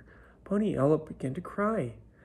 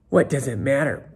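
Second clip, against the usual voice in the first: loud scornful sneer; mean pony mocking Poniella, loud sneer; 8 dB louder than usual. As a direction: sneer it down at her, loudly. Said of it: "Bun Bun sneered".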